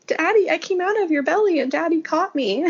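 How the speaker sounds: a hint of a proud small child reciting; imitating her son. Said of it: "he always says".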